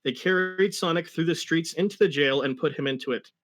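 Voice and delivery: ordinary talk, nothing marked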